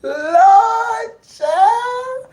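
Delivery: high, teary laugh on lord; laughing to tears. Real, not acted. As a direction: wheeze the words out laughing. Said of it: "laugh till I teared up".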